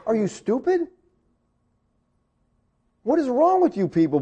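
baffled scolding without raising the volume; mock disbelief. Preacher reacts to complaining Israelites, incredulous. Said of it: "I'm like".

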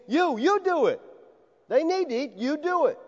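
high, insistent "you" pointing at the disciples; Jesus insisting the disciples feed the crowd, emphatic and high; emphatic command. Retold or imitated. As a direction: press it on them, you do it. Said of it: "is emphatic".

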